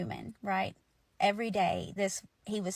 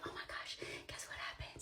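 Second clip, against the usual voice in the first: very soft, voiceless urgent whisper; panicky whisper; 16 dB quieter than usual. Retold or imitated. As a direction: whisper it like secret breaking news. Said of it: "panic, quiet whisper where you're like".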